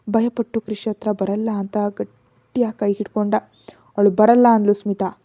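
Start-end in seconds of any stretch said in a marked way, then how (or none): 4.0-4.5 firm refusal. Acted. Smita flatly refusing.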